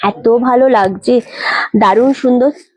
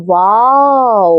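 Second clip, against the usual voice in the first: long, slow, stretched-out wow; a slow admiring wow to the viewers; drawn-out wow; 2 semitones lower, much slower than usual. Real, not acted. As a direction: linger on the wow, marvelling at the saree. Admiring a saree on live.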